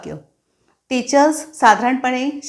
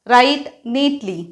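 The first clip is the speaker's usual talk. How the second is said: slow teacher command; much slower than usual. Shown as a demonstration. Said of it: "मग teachers काय सांगतात त्या मुलांना".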